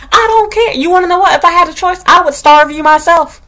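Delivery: high, heated, over-the-top outburst; mock exasperated scolding. Teacher to boy avoiding his lunch, mock exasperated. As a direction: rant it in exaggerated fed-up disbelief.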